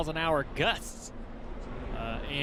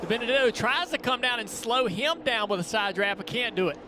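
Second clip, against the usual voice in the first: higher, louder, excited race call; live play-by-play; 6 semitones higher, 3 dB louder than usual. Real, not acted. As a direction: call the pass as it happens.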